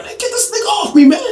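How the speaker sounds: high yelled plea; shouting for help. Retold or imitated. Teller retelling calling for help mid-fight, high yell. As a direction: scream to get him pulled off.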